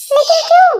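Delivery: loud high cry of protest; shocked why. Acted. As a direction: stop him in disbelief. Shocked plea as he leaves.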